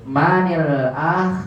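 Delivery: MANIL AH, man joined to al by an i, slowly; linked with kasrah. Shown as a demonstration. Teacher re-testing students on the reading, slow linked MANIL AH. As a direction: draw out MA-NIL, then AH. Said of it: "Kalau begini gimana bacanya?".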